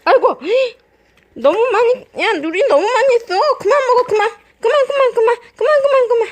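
high, loud and hurried; urgent stop. Real, not acted. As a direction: stop the dog right now. Dog overeating, owner stops it.

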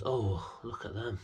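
usual speaking voice

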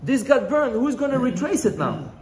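high-pitched, acted lament. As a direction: wail it mildly as a helpless question. Mother laments the burnt scroll, acted.

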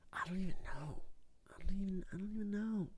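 very soft, trailing near-whisper; barely voiced, lost muttering; defeated muttering. Real, not acted. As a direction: mumble it helplessly under your breath. Giving up, muttering to himself.

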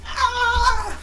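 sudden high-pitched yelp; a quick high shriek as he flips; mock scream. Retelling the clerk's stunt with a high mock shriek. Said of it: "he's just flipping and screaming".

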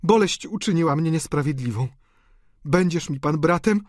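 emotional woman asking pardon; contrite countess. A narrator's reading. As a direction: appeal earnestly for brotherly friendship. Tearful countess apologises to Eugène.

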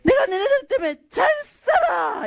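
high-pitched mother's yell, comic twist on 잘; mock scolding yell. Young mother yelling at her twins in the bank, mock-angry shout. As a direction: holler it like a scolding, landing hard on 잘. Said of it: "애들을 향해 소리를 쳤어요".

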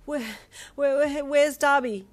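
high, loud, stammering whimper; frightened whimper. A narrator's reading. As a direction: stammer it out, holding back tears. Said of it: "you whimpered".

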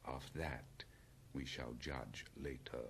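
low, quiet, dry rebuke. A narrator's reading. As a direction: reserve judgement drily, very softly. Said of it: "in a dry voice".

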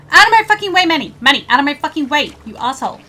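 furious shout at a teammate; angry yelling. Real, not acted. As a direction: bark it out, fed up and loud. Yelling at blocking companion.

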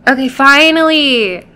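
bright, relieved cheer; finally, it worked; excited relief. Real, not acted. Relieved after long wait.

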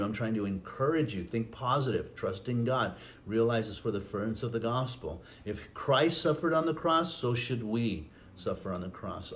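ordinary talk, nothing marked

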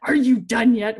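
impatient woman knocking again; impatient demand. Retold or imitated.

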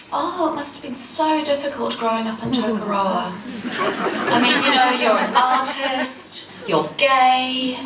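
a hint of a posh New Zealand accent, slowing on artist. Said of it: "in their posh New Zealand accents".

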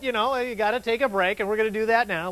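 usual speaking voice

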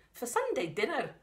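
a hint of a Scottish farm mum. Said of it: "the mum has got the Scottish voice".

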